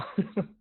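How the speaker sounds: short high laugh to hold back tears; a quick high laugh after vowing not to cry; nervous laugh. Real, not acted. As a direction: laugh it off, fighting the emotion.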